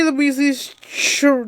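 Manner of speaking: normal talking voice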